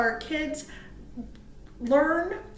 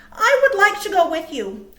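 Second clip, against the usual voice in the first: Little Bird; 7 semitones higher, 9 dB louder than usual. Acted. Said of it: "said Little Bird".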